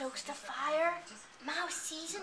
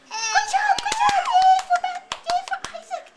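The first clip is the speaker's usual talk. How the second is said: excited praise; 11 dB louder, much faster than usual. Real, not acted. Praising child after story.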